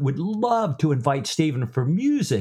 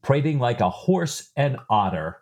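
normal talking voice

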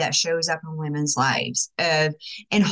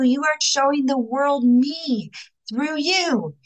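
God's voice; 5 semitones higher, slower than usual. Retold or imitated. God telling her why she must shine, proclaiming. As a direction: announce it like a loving revelation. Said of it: "He's like".

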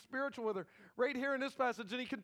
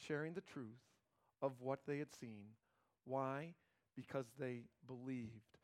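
hushed solemn; 8 semitones lower, 7 dB quieter than usual. Acted. Solemn quiet preaching.

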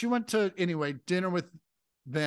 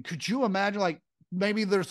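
ordinary talk, nothing marked

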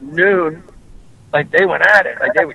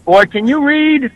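imitating Nipsey Russell; 9 semitones higher, slower than usual. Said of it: "and says".

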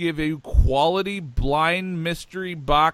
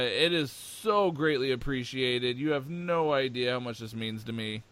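normal talking voice

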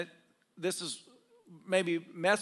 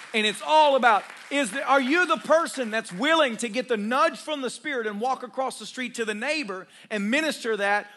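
fervent pulpit preaching, louder; 4 semitones higher, 4 dB louder than usual. Acted. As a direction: drive it home to the congregation. Preaching to congregation.

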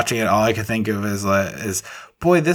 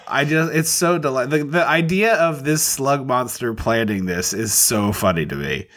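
normal talking voice

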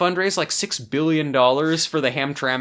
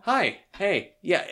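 awkwardly casual; 6 semitones higher, slower than usual. Retold or imitated. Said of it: "There is a weird energy".

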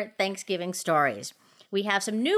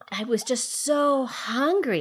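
whispering; 4 semitones higher than usual. Retold or imitated. Remembering hunger as a child.

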